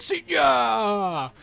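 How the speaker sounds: high, loud hammed-up goodbye; gleeful action-hero taunt; mock taunt. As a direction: shout it as the final blow. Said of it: "And he's like".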